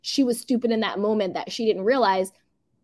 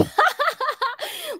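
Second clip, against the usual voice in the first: high laugh. Real, not acted. Laugh at comment.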